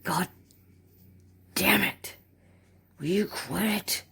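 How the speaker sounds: mildly hammed-up startled annoyance; mock exasperation. Startled boss tells worker to quit it, mock exasperation.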